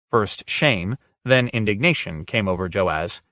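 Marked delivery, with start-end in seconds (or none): none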